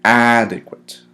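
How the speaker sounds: "Adequate" with a loud, lengthened first syllable; stress on first syllable. Shown as a demonstration. Teacher showing where the accent falls, "Adequate" stressed on first syllable. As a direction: lean hard on the start of "Adequate", then drop off. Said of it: "accent is placed on the first syllable".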